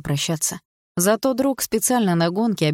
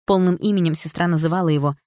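plain reading voice

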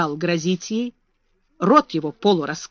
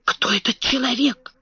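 alarmed loud whisper; 4 semitones higher, 3 dB quieter than usual. A narrator's reading. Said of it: "громким тревожным шёпотом".

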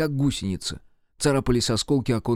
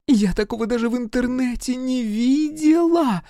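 very high excited squeal; 13 semitones higher, 3 dB louder than usual. A narrator's reading. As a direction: squeal it out, stretching the end. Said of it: "повизгивала Вика". (восторженно повизгивать)

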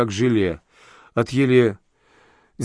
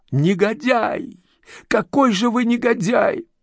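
much higher screamed insult; shrill scream; 10 semitones higher, slower than usual. A narrator's reading. Girl hurling insults at the boss as she flees. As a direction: screech the insult. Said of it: "Визгливые крики".

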